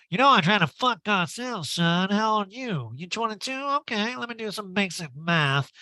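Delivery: high, loud, hammed-up come-on; Erica impression.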